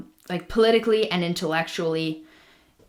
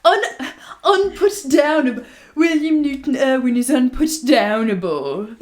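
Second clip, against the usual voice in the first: high, laughing mock British accent, hammed up; mock posh British; 5 semitones higher, 4 dB louder than usual. Cohost joking about a dull historical figure, laughing posh British accent. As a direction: savour the fancy word in a posh British voice. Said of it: "Why am I doing a British accent?".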